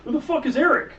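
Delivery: loud, high-pitched disbelief; exasperated, incredulous yelp; incredulous outburst. Acted. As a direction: demand an answer, loudly baffled. In-character outburst at unknown name.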